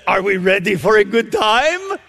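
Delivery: put-on Romanian accent projected to a crowd, rising at the end; lively imitated Romanian accent. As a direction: mimic the Romanian grandmother for the audience. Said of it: "she says in this unique accent".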